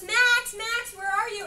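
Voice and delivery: high, loud call across a store. Retold or imitated. Sister searching for Max in the store, calling loudly. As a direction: call for her lost brother. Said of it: "and she goes".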